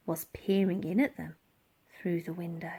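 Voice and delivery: sinister, whispered reveal; hushed whisper. A narrator's reading. Narrator revealing someone watching at the window, whispered. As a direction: drop to a whisper.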